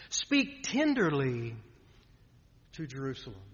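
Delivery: tender. Shown as a demonstration. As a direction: let the words comfort gently, slowing down. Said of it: "notice the tone of comfort".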